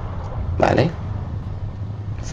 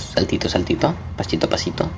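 sneaky whisper; 3 semitones lower, 9 dB quieter than usual. Real, not acted. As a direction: tiptoe it in a whisper. Sneaking past enemies.

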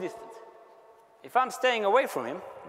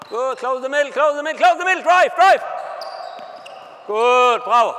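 loud, higher shouted commands; coach yelling; 4 semitones higher, 10 dB louder than usual. Real, not acted. Coach shouting at players to close the middle. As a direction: bellow it to the defense.

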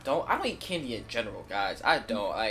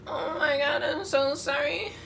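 whiny apology voice; 14 semitones higher, 3 dB louder, slower than usual. Acted. Apologizing while eating.

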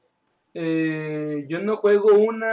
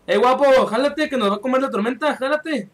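higher, hurried call to a teammate; urgent, raised-pitch game callout; 4 semitones higher, 3 dB louder than usual. Real, not acted. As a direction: call out to get someone moving before the storm hits. Urging teammate to flee the storm.

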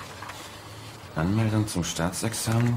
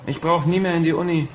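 louder, higher and fast; excited shout; 11 semitones higher, 6 dB louder, much faster than usual. Acted. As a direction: burst out with relief. Joy at escaping university.

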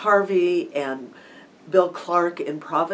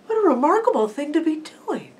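higher-pitched, wondering remark; mild amazement; 11 semitones higher, 5 dB louder, faster than usual. Retold or imitated. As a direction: marvel at it, voice lifted and a little louder. Man marvels at shouting stranger.

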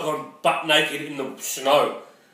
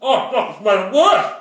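louder pained outcry; pained outburst; 5 semitones higher, 6 dB louder than usual. Real, not acted. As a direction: cry out as the heat worsens. Mouth burning from hot food.

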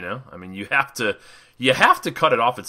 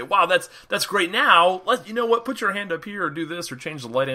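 higher-pitched acted enthusiasm; bright cover-up praise, pitched up; upbeat with client; 8 semitones higher, 3 dB louder than usual. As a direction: play the encouraging photographer directing a client. Demonstrating upbeat words to a client.

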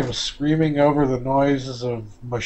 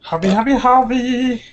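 excited greeting; 11 semitones higher, 8 dB louder, slower than usual. Real, not acted. Greeting Javi who just arrived.

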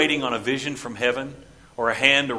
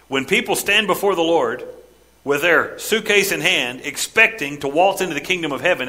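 ordinary talk, nothing marked

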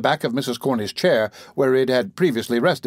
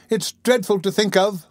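higher-pitched dismay; shuddering; 7 semitones higher than usual. A narrator's reading. Lady telling the beadle of her upset, shuddering. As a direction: recoil from the very thought.

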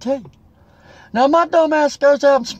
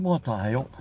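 low disgusted aside; 11 semitones lower, 10 dB quieter than usual. Retold or imitated. Inner thought, retelling the loan problem.

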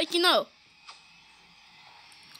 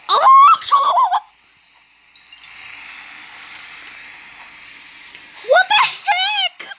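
very high, loud shriek with laughter; laughing scream; 3 dB louder than usual. Real, not acted. Player reacting to the game, laughing scream.

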